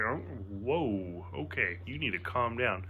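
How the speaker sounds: low, soft deadpan; deadpan calming. Retold or imitated. Telling his excited friend to calm down, low and deadpan. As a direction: talk a hyper friend down, unimpressed.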